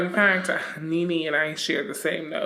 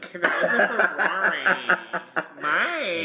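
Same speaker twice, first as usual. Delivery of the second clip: higher, giggly spoiled whine; spoiled diva, laughing; 3 semitones higher, slower than usual. Retold or imitated. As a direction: beg for a Ferrari through laughs. Imitating Brit asking Mike, laughing.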